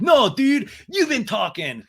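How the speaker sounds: high, loud dude imitation; mock indignant protest. Sandoval interrupting Lala, high loud protest. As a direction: burst out in mimicked outrage. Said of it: "And Sandoval's like".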